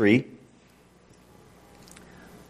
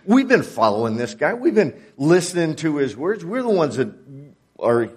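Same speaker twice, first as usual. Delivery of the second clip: jealous complaint; 2 semitones higher than usual. Acted.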